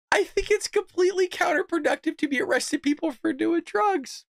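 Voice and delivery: high, incredulous rereading on the edge of laughter; cracking up reading. Real, not acted. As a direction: read the quote while fighting off laughter. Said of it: "Oh, God, I'm crying".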